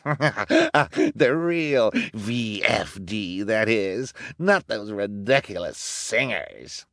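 villainess cackle into scornful talk; cackle, then sneer slowly; cackling. A narrator's reading. Villainess scorns the singers to her gang, cackling. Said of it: "said with a cackle".

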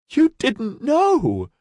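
high, slow, drawn-out wail; nearly a wail. Acted. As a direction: wail through tears of relief. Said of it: "It was nearly a wail".

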